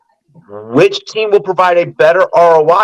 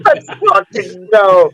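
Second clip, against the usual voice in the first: excited cheer; 6 semitones higher than usual. Real, not acted. Celebrating the guest joining.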